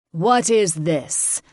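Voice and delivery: slow, mocking king; scornful dragon king. A narrator's reading. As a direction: sneer at the coward. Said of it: "tone changing from anger to pity".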